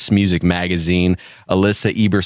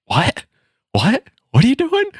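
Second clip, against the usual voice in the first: rising incredulous "What?"; astonished, climbing higher; disbelieving surprise. Retold or imitated. Friends' disbelieving reaction retold.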